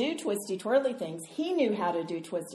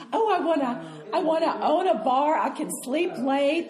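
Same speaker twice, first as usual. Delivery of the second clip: put-on higher, louder, wistful young dreamer; dreamy girlish wish; 5 semitones higher, 4 dB louder than usual. Young woman gushing about her dream bar. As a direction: gush about owning a bar.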